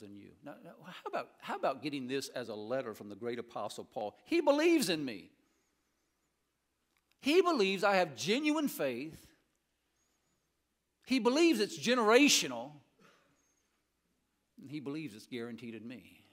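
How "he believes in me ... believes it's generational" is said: preaching at full force. Acted. Preacher telling the congregation Paul believes in them, preaching at full force. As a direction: proclaim it to the whole church.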